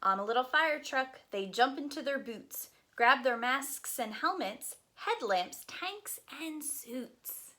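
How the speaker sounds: lively, sing-song picture book reading; reading to children. Acted. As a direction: read it aloud to a group of little kids.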